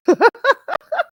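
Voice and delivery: a sudden high laugh; high burst of laughter. Real, not acted. Laughing at the man asking for reparations.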